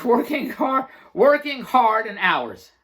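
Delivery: hammering the buzzwords he despises; mocking buzzwords. Shown as a demonstration. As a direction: lean on working with scorn. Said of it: "what else did I say".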